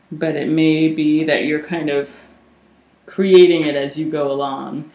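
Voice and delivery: breathless, winded speech; short of breath. Real, not acted. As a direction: speak short of breath, gasping slightly. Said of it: "I get short of breath".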